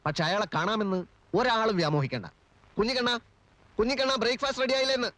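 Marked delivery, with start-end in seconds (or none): 2.8-5.1 higher, calling across the house. Acted. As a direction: call the servant to hurry breakfast. Calls servant from inside house. (ഉയർന്ന ശബ്ദത്തിൽ വിളിച്ചു ചോദിക്കുന്നു)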